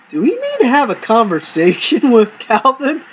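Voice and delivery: asking through laughter; laughing. Real, not acted. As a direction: hold back a laugh while asking. Laughing question.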